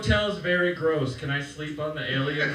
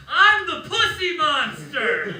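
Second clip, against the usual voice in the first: high, loud, drawn-out mock scream; screaming mom; 11 semitones higher, 7 dB louder, slower than usual. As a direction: belt it out like an overexcited rap fan mom. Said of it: "she would just be screaming".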